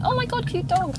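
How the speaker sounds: sudden high-pitched delight; excited outburst. Real, not acted. Podcaster spotting a cute dog mid-thought, real high-pitched excitement.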